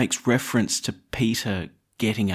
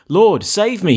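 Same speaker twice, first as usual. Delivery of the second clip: a higher, louder cry for help, a touch of panic; crying out in fear; 9 semitones higher, 7 dB louder, slower than usual. Acted. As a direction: call out as if sinking. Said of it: "he cried".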